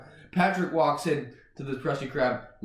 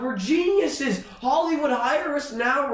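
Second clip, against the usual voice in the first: high, drawn-out elated cry; giddy boasting; 8 semitones higher than usual. Real, not acted. Co-writers pitch to Hollywood, high excited crowing. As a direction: crow with excitement. Celebrating their own idea.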